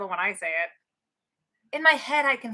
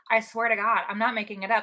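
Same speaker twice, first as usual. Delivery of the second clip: usual speaking voice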